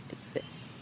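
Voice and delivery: a slow parting whisper; whispered confidence; hushed whisper. Acted. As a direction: breathe his name, slow and low.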